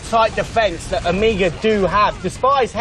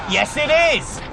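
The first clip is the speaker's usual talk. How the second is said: usual speaking voice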